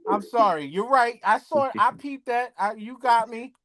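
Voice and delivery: high, protesting apology; high-pitched apology. Real, not acted. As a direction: back down in a high voice. Caught out, apologizing over teasing.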